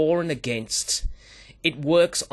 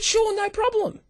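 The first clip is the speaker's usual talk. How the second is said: mock-breezy yes, pitched way up; hammed-up obliging husband, much higher; easy agreement. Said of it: "I said".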